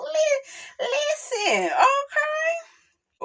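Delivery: high squeal through laughter; cracking up, voice way up high; laughing squeal. Real, not acted. Cracking up over coach.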